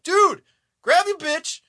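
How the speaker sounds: high, loud yell of advice; yelling at screen. Retold or imitated. Viewer yelling at the hero to grab his wife, high and loud. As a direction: shout at the hero to grab her and run.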